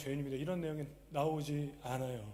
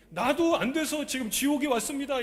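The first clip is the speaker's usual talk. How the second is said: higher, louder cry from hell; rich man's outcry; 10 semitones higher, 8 dB louder than usual. Retold or imitated. Preacher voicing the rich man in hell, loud and high. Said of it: "얘기하는 거예요, 부자가".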